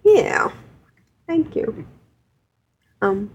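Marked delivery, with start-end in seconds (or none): 0.0-0.5 a quick delighted yes; keen yeah; eager yeah. Real, not acted. Enthusiastic agreement.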